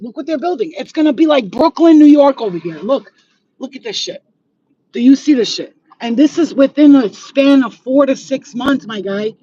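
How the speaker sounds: usual speaking voice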